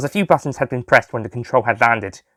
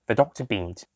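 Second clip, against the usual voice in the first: ordinary delivery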